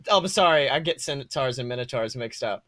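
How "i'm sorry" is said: ordinary talk, nothing marked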